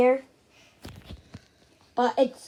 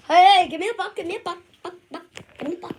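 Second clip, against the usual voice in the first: put-on Canadian accent; 2 semitones higher, much faster than usual. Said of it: "I sound like a Canadian".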